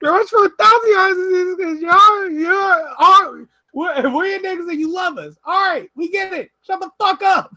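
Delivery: high, loud laughing rant; imitated yelling rant. Friend in the car ranting at BJ, high and loud. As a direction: rant at him, half laughing. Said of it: "Caleb just howled".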